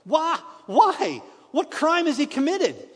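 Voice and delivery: high, loud, insistent questioning; urgent challenge. A narrator's reading. Pilate demands the crime from the shouting crowd, loud challenge. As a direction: throw each question loudly back at the mob.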